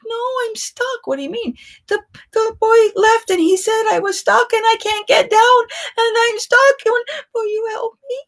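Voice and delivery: high, whimpering cry for help; near tears. Retold or imitated. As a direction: whine like a child stuck on a bench. Tells girl she's stuck, whimpering.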